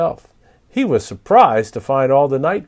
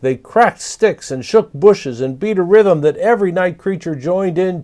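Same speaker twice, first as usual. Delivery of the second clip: ordinary delivery, nothing marked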